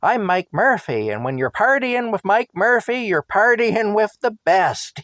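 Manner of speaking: put-on high, loud, bragging drunk voice; boastful drunk. Drunk friend bragging to the taxi driver, high and loud. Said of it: "he says to me".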